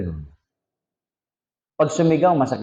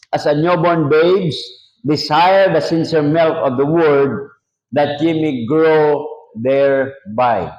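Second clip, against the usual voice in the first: scripture reading; 2 semitones higher, slower than usual. Acted. Preacher reading scripture aloud.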